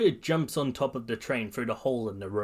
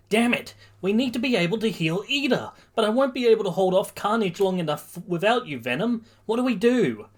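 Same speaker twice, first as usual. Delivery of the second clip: high, raised anxious voice; urgent distress; 9 semitones higher, 4 dB louder than usual. Acted. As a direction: fret aloud in mid-battle panic. Boy panicking mid battle.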